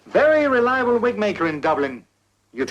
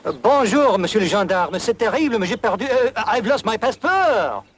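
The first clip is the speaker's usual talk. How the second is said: put-on French accent; 4 semitones higher than usual. Disguised man telling border officer of lost passport, French accent. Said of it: "That is a French accent".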